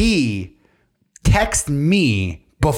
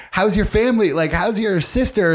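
chirpy robot operator; 8 semitones higher than usual. Pretend. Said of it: "they're like".

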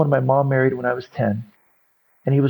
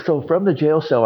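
usual speaking voice

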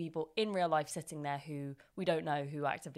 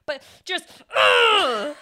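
higher, louder straining grunt; mock Hulk strain; 8 semitones higher, 13 dB louder, much slower than usual. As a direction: heave like bursting out of a dress. Bursting buttons like the Hulk.

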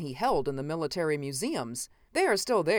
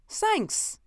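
1 unpleasant mocking thanks. A narrator's reading. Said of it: "laughed, not pleasantly".

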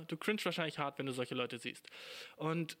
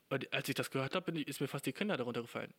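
usual speaking voice

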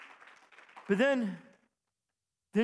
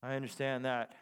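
knowing; 5 semitones lower than usual. Retold or imitated. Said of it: "I said".

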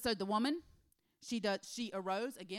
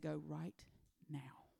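hushed inner voice; 5 semitones lower, 7 dB quieter than usual. Retold or imitated. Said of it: "a discerning spirit that said".

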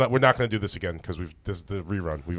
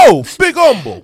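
laughing; 13 dB louder than usual. Real, not acted. Mocking the fake accent.

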